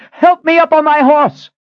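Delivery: high, loud shouted order; urgent shout. A narrator's reading. Woman ordering one-eyed man mid-battle, urgent shout. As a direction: yell it over the fighting.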